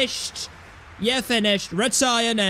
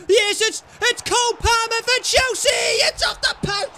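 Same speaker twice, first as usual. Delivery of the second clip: high, loud yell building to a goal that never comes; real frantic match-call yell; excited commentary shout; 11 semitones higher, 4 dB louder than usual. Real, not acted. Near goal hits the post.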